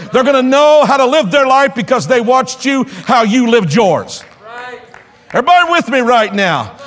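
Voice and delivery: high, driving sermon delivery; rousing pulpit exhortation; impassioned preaching. Real, not acted. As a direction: preach it hard, pressing the congregation. Preaches at full force.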